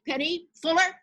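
high, loud call of a name; teacher calling a name. Retold or imitated. Teacher calling out a student's name, high. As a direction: sing out the name across the room. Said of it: "she said".

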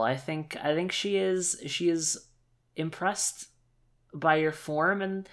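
usual speaking voice

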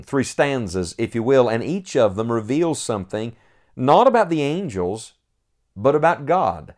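ordinary talk, nothing marked

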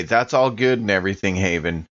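ordinary talk, nothing marked

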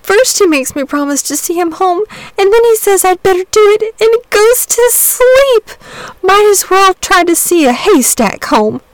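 put-on high, slowing tearful boy's voice; a tired child breaking down into tears. A narrator's reading. Boy telling Saint George his trouble, tearful. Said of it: "here he broke down again".

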